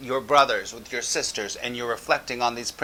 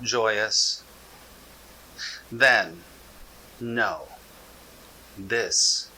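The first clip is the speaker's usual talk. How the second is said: slow contemplative reading; slower than usual. Shown as a demonstration. Teacher reading so listeners feel the words, slowly and contemplatively. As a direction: let each word sink in, almost word by word. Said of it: "the way that you can get the most benefit out of it".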